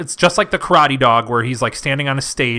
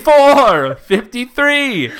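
countdown announcer; 9 semitones higher, 6 dB louder, slower than usual. Retold or imitated. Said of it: "he's, like, dancing".

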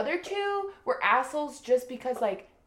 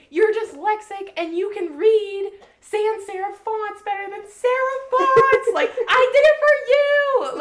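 much higher and louder, building to a yell; husband yelling his confession at his wife, building louder; mock screaming; 11 semitones higher, 9 dB louder than usual. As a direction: shout it like a frustrated confession. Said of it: "they're screaming at each other".

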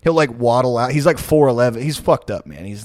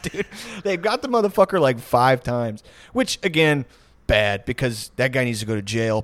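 ordinary delivery

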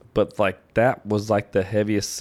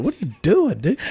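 high-pitched, indignant retold question; retold exasperation; 7 semitones higher than usual. Telling a friend off over flying hair, exasperated. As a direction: demand an explanation, in mock exasperation.

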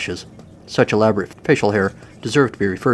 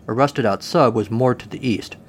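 plain reading voice

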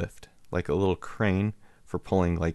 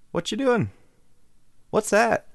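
put-on high, eager little-kid voice; nosy little kid; 13 semitones higher, 4 dB louder than usual. Neighbor kid quizzing the man under his car, high little-kid voice. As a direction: pester like a curious small boy. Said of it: "this little dude's".

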